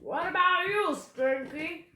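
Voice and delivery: high, loud captain's bellow; loud, impatient pirate call; pirate roar. A narrator's reading. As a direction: roar the question at a crewman. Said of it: "Captain Bones roared".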